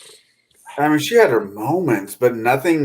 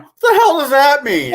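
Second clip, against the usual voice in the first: much higher, louder, incredulous yelp; shocked outrage; 5 dB louder than usual. Retold or imitated. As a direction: squeal it in disbelief. Daughter runs up outraged.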